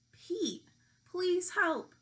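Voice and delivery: pleading note. Acted. Easter Bunny's note read aloud.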